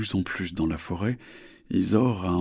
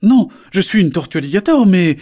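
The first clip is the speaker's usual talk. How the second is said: high, loud, indignant; loud protest; 12 semitones higher, 13 dB louder than usual. Acted. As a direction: shout the correction. Isor corrects loudly.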